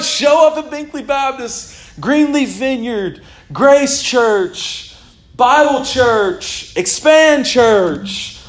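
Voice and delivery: re-enacted drive-by prayer, high and emphatic; fervent prayer call. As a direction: call out blessings church by church. Re-enacted prayer over churches driven past.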